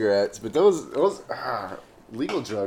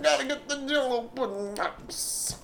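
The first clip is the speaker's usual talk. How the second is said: silly high voice; 14 semitones higher, 4 dB quieter, slower than usual. Pretend. Mock voice joke.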